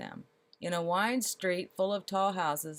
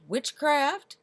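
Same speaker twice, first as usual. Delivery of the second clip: loud, much higher cry of alarm; alarmed shout; 9 semitones higher, 9 dB louder than usual. A narrator's reading. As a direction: fling the word out in alarm. Lord alarmed at the tree.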